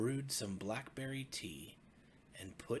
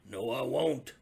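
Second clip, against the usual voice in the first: put-on Old Bear refusing the cub; stubborn old bear; 2 semitones higher, 9 dB louder than usual. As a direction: dig in your heels like a grumpy bear.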